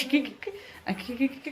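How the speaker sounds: lightly imitated spooky snicker; ghostly giggle. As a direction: mimic kids giggling like ghosts. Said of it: "भूत जैसे खड़े होकर के खी".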